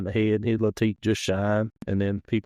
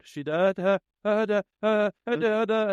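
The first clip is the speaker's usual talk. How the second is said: high, stuttering mock shriek; choppy mock scream of a startled woman; imitated scream; 9 semitones higher than usual. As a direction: mimic her panicked screaming in bursts. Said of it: "and she screamed".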